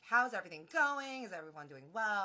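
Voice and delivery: high, loud cheery chef check-in; bright host voice checking on guests. Retold or imitated. Chef greeting the dinner table, loud and cheery.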